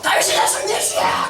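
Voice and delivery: strained cry for help; desperate shout. Retold or imitated. Mother with a dying child crying out to Jesus, desperate shout. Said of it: "여자가 너무 소리를 지르는 거야".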